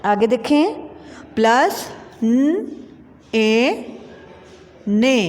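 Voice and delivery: slow, drawn letter-by-letter spelling. Shown as a demonstration. Teacher spelling a word for pupils, slow and drawn out. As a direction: sound out each letter slowly with long gaps. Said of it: "तो ये क्या अल्फाज बना".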